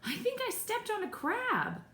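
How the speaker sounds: high-pitched dismay; high, acted distress; pained alarm. A narrator's reading. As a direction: complain in sudden mock pain. Pained by a crab in story.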